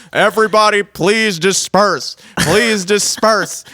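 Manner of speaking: mock loudhailer order; megaphone announcement. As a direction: imitate a police megaphone, drawing out each phrase. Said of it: "the big ass megaphone shouting down".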